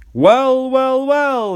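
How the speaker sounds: high, loud drawn-out well; Percy teasing Thomas with a high exclamation; exclaiming. A narrator's reading. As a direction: sing out the wells in mock surprise. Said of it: "he exclaimed".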